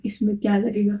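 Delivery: usual speaking voice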